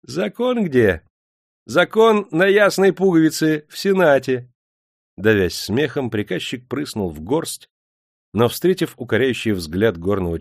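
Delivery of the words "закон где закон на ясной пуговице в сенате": unflappable mock. A narrator's reading. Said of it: "с невозмутимым видом ответил".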